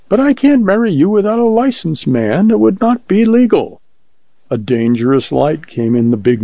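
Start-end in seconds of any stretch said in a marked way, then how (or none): 0.1-3.8 high-pitched little minister, objecting; little preacher protesting. Acted. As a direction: protest in a much higher voice.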